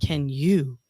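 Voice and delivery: slow, low, drawn-out announcer voice; dramatic trailer voiceover. Acted. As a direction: growl the challenge like a film trailer. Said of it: "I did that voiceover".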